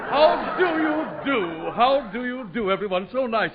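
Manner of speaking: high, slow, shouted la-di-da greeting; grand entrance. Acted. Von Zell arriving gone Hollywood, hammed-up grand greeting. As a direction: sweep in like a preening star.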